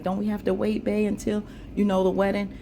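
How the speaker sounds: high, cooing sweet talk to her man; imitating a wife sweet-talking her husband into waiting. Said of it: "she thought she can sweet talk him".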